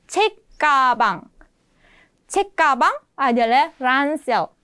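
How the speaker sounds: 책가방 drawn out slowly, syllable by syllable; slow teaching read. Shown as a demonstration. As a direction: say cek kabang slowly for learners. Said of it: "teaching new word".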